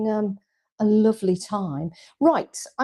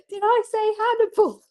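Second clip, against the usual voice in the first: laughing; 3 dB louder than usual. Real, not acted. Laughing at her own slip.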